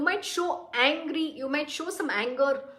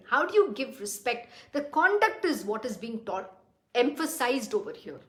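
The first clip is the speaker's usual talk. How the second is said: ordinary delivery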